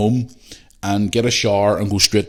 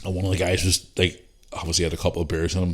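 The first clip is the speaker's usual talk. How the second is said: normal talking voice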